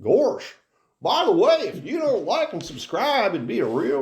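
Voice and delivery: high, Southern Goofy with an innocent threat; a goofy cartoon dog making a sweet little threat. Host threatens viewers who won't subscribe, in high Goofy voice. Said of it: "Why does your Goofy sound so Southern".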